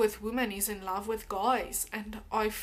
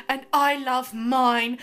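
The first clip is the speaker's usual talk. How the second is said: slow, put-on dumb-guy voice; dopey mock voice; 3 semitones higher, 9 dB louder, slower than usual. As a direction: ham up the silly commenter slowly. Quoting a commenter in put-on voice.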